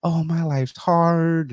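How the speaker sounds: high, slow, whiny mocking of complainers; mock whine. Mocking clients who say life is hard, slow high whine. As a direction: drag it out like a spoiled complainer. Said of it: "and they're like".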